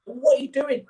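indignant player yelling at the manager; retold outraged yell. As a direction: shout it at the man who threw the tea. Confronting after tea thrown.

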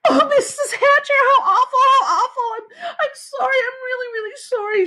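high, frantic wailing apology; crying. A narrator's reading. Sheila begging the mother's pardon, high tearful wail. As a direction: wail the apology through tears. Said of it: "Sheila cried".

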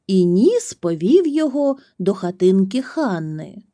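plain reading voice

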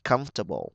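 Comfortable as COMF-ta-ble, falling; stress on first syllable. Shown as a demonstration. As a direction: punch COMF, drop the rest of Comfortable. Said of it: "Chúng ta nhấn âm một luôn". (nhấn âm một Comfortable)

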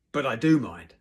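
loud, slightly high rebuke; stern objection. A narrator's reading. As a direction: cut in forcefully as the teacher. Teacher stops a fight.

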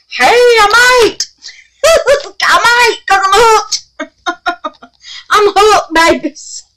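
very high, loud squeal with laughter between phrases; gleeful excitement. Real, not acted. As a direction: squeal it out, tickled at yourself. Gleefully admitting she is hooked.

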